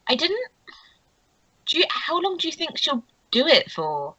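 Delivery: high, inquisitive character voice; Meredith. Acted. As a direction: pitch it up for a curious question. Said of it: "that was more Meredith's voice".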